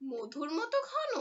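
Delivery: high startled question. A narrator's reading. Girl startled at the shopkeeper's claim, high surprised question. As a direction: jump up surprised at the word. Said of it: "চমকে উঠে বলল".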